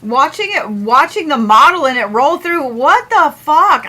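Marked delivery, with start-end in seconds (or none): 2.7-3.9 slow, higher, louder what the fuck; stunned disbelief. Real, not acted. Streamer watching the weather model roll through, stunned and loud. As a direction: drag out the words in shocked disbelief.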